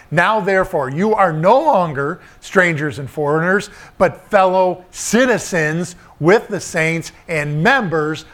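rising, emphatic preacher's cadence; preaching. Acted. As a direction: preach it to the congregation with conviction. Preaching to the congregation.

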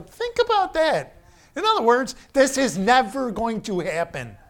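high, loud, excited preaching; fervent exhortation. Real, not acted. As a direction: cry it out to the room.

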